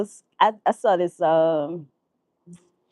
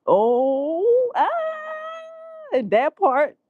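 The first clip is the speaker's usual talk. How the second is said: high, long laughing cry; 10 semitones higher, much slower than usual. Real, not acted. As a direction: let the laugh ride up high. Laughing at the [name] line.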